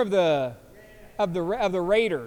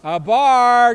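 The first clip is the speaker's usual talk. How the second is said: louder, higher punchline; punchline burst; 4 semitones higher, 10 dB louder, much slower than usual. Real, not acted. Host blurts the bar pun to listeners, loud. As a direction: land the pun with a loud pop.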